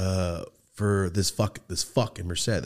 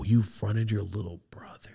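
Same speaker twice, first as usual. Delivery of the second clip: slow awed whisper; imitating an awed whisper; 4 dB quieter than usual. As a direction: breathe it out in awe, slowly. Kid awed by fronting.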